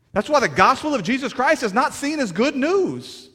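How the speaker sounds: loud, high, driving sermon voice; impassioned preaching at full volume; full-force preaching. Acted. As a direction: preach it hard to the congregation.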